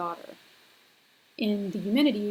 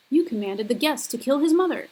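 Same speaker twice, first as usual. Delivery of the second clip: higher, louder accusation of Apollo; accusing Furies leader; 4 semitones higher, 5 dB louder than usual. Acted. Furies leader accuses Apollo before the class, higher and louder. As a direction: hurl "You commanded the guest" as a charge. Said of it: "the leader of the Furies is speaking to Apollo".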